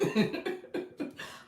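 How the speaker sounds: breathy high-pitched giggle; amused high giggle. Real, not acted. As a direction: burst into a light high laugh. Laughs at her own joke.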